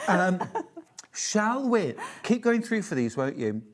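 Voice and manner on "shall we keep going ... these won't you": ordinary talk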